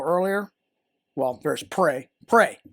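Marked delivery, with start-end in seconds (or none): 2.3-2.6 commanding. Shown as a demonstration. Commanding example, loud.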